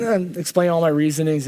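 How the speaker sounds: normal talking voice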